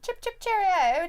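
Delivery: mock British phrase.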